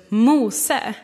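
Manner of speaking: loud, ringing call of wonder; joyful call. Acted. God calling Moses with joy, loud and bright. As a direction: shout the name with delight. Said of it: "han ropar liksom av glädje eller förundran".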